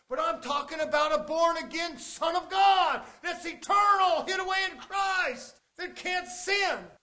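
fiery preaching. Acted. Preacher rising again.

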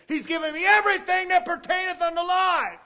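preacher's shout. Acted. Repeated line, now shouted higher.

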